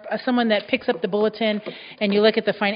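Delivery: ordinary talk, nothing marked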